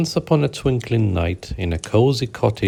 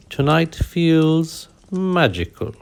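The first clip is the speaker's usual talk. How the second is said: higher, slow theatrical purr; dramatic purr; 7 semitones higher, slower than usual. Acted. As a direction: savor the words like a showy cat. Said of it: "he purred dramatically".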